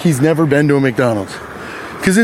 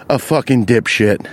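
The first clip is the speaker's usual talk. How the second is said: normal talking voice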